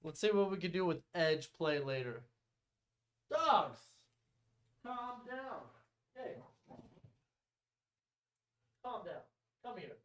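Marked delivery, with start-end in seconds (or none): 3.3-10.0 hushing the dogs. Real, not acted. Hushing his dogs off-mic.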